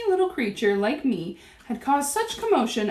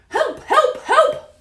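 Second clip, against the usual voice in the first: very high, loud cries for help; screaming; 14 semitones higher, 7 dB louder, much slower than usual. A narrator's reading. Cook begs the kitchen for help, screaming. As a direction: shriek out in comic panic. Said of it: "the man was screaming".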